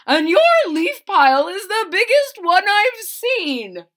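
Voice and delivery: excited blue jay. Acted. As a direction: boast like a bird who has seen it all. Blue jay voiced in story.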